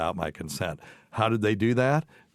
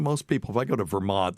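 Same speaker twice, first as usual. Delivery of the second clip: ordinary delivery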